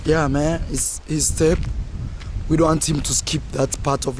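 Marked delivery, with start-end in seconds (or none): none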